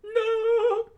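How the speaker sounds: very high, loud protest; high loud cry. Acted. Fuko crying out to Andy, high loud protest. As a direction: cry his name out.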